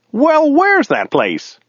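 very high, drawn-out character voice; baffled driver. A narrator's reading. Driver puzzling over his passenger's origins, high slow character voice.

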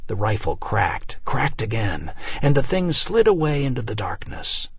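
ordinary delivery

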